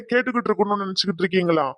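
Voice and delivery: ordinary delivery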